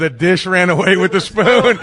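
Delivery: giddy laughing. Real, not acted. Giddy nursery rhyme joke.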